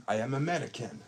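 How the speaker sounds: loud mock Russian accent; hammed-up Russian accent, loud. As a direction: declare it proudly in a put-on Russian accent. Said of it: "when a Russian person does it, says American".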